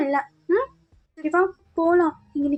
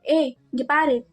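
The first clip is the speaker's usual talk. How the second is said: ordinary talk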